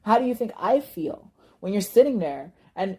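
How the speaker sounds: ordinary delivery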